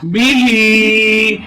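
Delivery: बी ही said very slowly with the ई of ही stretched one alif length; slow recitation demo of बी ही; drawn-out madd. Shown as a demonstration. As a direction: hold the ई in ही long, like a chant. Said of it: "एक अलिफ की मकदार खींच कर पढ़ेंगे".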